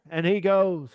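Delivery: ordinary talk, nothing marked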